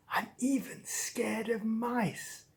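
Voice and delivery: soft, slow near-whisper; fearful confession. Acted. Cat confesses worst fear.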